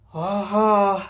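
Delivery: put-on pleased old nobleman musing aloud; creaky wise old man. A narrator's reading. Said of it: "黄門様がそう言った".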